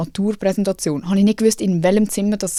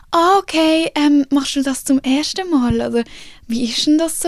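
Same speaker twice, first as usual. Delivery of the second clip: patronizing event staff; 6 semitones higher than usual. Retold or imitated. Event staff doubting the young speaker, high and condescending. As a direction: talk down to her like a nervous kid. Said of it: "dann sind sie immer so".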